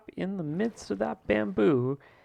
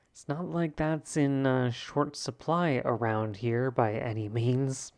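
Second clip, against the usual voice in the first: ordinary talk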